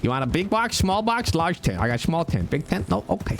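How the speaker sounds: high-pitched travelling merchant hawking his wares; Jimmy Two Toes. Pretend. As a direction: pitch it up like a pushy street trader. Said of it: "I could make Jimmy Two Toes".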